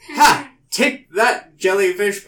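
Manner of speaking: loud victorious cry; triumphant shout. Retold or imitated. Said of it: "I'm just like".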